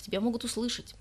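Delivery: plain reading voice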